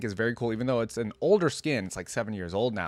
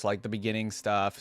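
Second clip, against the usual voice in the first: ordinary talk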